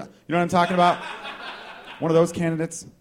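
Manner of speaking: usual speaking voice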